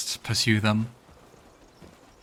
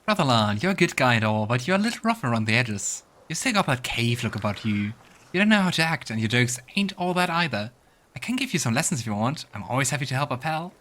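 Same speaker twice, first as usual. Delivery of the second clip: louder, cocky voice for a game companion needling his friend; companion's teasing jab; 3 dB louder than usual. Acted. Companion mocking Brotherland's rough manners, cocky and loud. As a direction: read it like a smug buddy giving advice.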